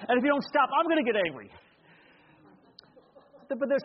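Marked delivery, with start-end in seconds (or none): 0.1-1.5 higher, hammed-up yelling parent; mock angry yell. Shown as a demonstration. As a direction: snap at the kid like a losing-it dad. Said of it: "I can yell at him and say".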